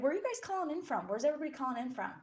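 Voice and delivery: quick, bright eager question; excited. Real, not acted. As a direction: ask eagerly, high and fast. Eager question to live viewers.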